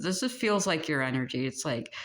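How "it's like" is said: usual speaking voice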